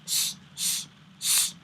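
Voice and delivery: heavy Arabic S from the middle of the mouth; thick emphatic Saad S. Shown as a demonstration. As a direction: hiss a deep heavy S. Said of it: "We're doing the pump S".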